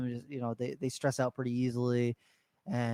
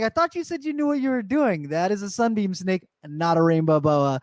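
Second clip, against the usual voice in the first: calling him out; 5 semitones higher, 4 dB louder than usual. Retold or imitated. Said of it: "I was like".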